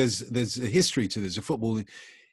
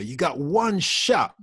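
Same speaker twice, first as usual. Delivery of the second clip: higher, louder and slower, quoting [name]; [name] quote; 7 semitones higher, 5 dB louder, slower than usual. Retold or imitated. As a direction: punch the lyric out like a rapper. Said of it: "like [name] would say".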